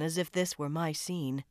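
ordinary delivery

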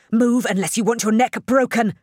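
loud, high, urgent threat; forceful shouted warning; snapped warning. A narrator's reading. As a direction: snap it out to get him moving. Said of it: "Cheta snapped".

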